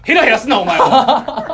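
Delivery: loud laughing scold; wrestling promo. Acted. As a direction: bark at the grinning rival. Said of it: "プロレスのマイクパフォーマンスみたいになってたから".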